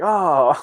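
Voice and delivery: loud, high "oh"; mock grossed-out groan. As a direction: imitate a recoiling crowd. Acts out onlookers' reaction to puking.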